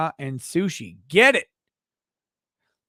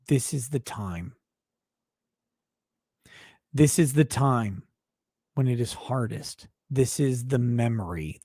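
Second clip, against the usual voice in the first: solemn tribute reading; 4 semitones lower than usual. Acted. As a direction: read it like a eulogy at a graveside. Reads solemn tribute message.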